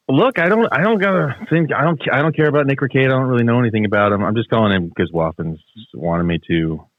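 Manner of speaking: ordinary talk, nothing marked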